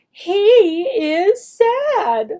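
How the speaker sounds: slow, high, exaggerated model; slow modeled sentence. Shown as a demonstration. Teacher models the answer to a young learner, slow and high. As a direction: stretch every word for the child.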